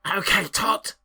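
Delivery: high, loud goblin reply. Acted. Grappled goblin answers the one he thinks is Tot, high goblin voice. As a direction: squawk it high like a little goblin. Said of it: "he's heard the goblin voice".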